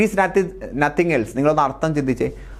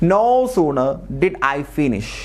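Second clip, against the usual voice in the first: slow dictation read; much slower than usual. Shown as a demonstration. Reading out exam sentence.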